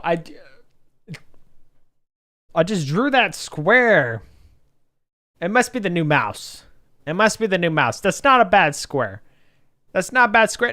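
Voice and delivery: loud, high-pitched real amazement at yourself; pleasantly amazed. Real, not acted. Amazed at own drawn square.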